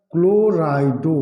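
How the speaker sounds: क्लोराइडो stretched slowly, syllable by syllable; drawn-out term. Shown as a demonstration. Teacher answering how the ligand is named, drawn out. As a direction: say क्लोराइडो slowly, holding each part. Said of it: "क्या बोला जाएगा?".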